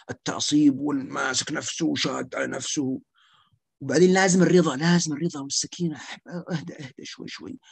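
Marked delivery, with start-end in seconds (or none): 6.3-7.6 softly whispered اهدأ, coaxing a patient to calm; soothing near-whisper. Shown as a demonstration. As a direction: hush them down gently, almost breathless.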